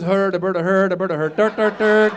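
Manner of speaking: sing-song mock-Swedish gibberish; bouncy nonsense in a cartoon Swedish accent; Swedish Chef. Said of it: "My only Swedish accent is".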